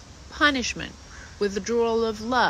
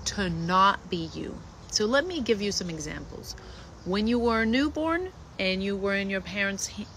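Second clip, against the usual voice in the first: ordinary talk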